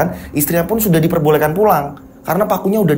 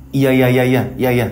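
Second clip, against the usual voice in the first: low, resigned iya iya; weary husband; 9 semitones lower than usual. A narrator's reading. Husband answering his nagging wife, low and resigned. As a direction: give in with a low sigh.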